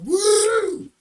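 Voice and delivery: high loud woo; party whoop. Retold or imitated. Friends partying on the drive to the beach, high excited whoop. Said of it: "We're like".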